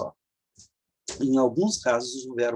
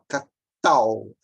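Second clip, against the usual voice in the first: catal drawn out, weight on final TAL; stress on last syllable; 4 semitones lower, 6 dB louder than usual. Shown as a demonstration. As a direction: split catal slowly and land hard on TAL. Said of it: "aqui é a sílaba forte".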